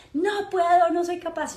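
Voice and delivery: put-on high, loud whiny child's protest; frustrated child. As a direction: cry out like a frustrated little boy. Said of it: "Daniel, mi hijo, me decía".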